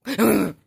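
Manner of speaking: sharp, loud gasp of shock; startled by the cat. Real, not acted.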